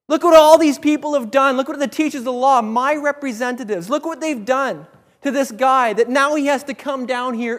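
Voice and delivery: mildly outraged on behalf of a suffering man; indignant rebuke, easing slightly; compassion and anger. Acted. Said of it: "a mixture of compassion and anger".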